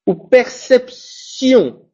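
perception drawn out, tion as sion; slow demo. Shown as a demonstration. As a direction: model perception slowly. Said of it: "on dit".

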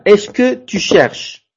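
slow, careful "est-ce que tu cherches?"; slow teaching demo. Shown as a demonstration. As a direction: spell out the French question slowly for beginners. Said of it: "En la segunda". (pregunta francesa dicha muy despacio)

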